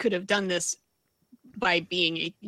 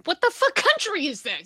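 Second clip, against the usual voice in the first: high-pitched mock outrage; exasperated outburst; 9 semitones higher than usual. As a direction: exclaim in played-up disbelief at it all. Acts out outraged viewer.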